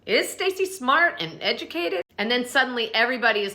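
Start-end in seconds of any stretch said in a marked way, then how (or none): 0.1-2.0 high, pointed skeptical question; catty reality-star jab; mock Giselle. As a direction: needle her with a higher voice. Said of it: "Giselle goes".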